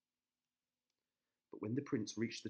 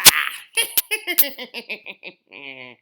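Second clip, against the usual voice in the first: evil cackle; 8 semitones higher, 20 dB louder than usual. Acted. Witch laughs.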